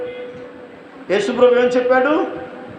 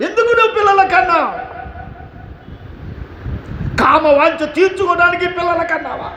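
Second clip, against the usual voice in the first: fiery preaching; 4 semitones higher than usual. Acted. Preacher rebuking careless parents, fiery shouting sermon. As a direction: berate the parents from the pulpit, climbing.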